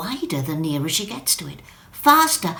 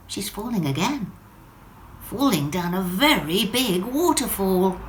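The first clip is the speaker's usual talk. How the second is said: ordinary delivery, nothing marked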